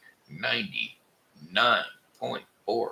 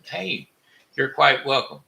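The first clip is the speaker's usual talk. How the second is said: touched thanks; 5 semitones higher, 6 dB louder than usual. Real, not acted. Touched reply.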